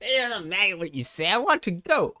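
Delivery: high-pitched put-on voice for a rebellious kid; hammed-up, high-voiced defiance; defiant runaway character. Voices game character's dialogue.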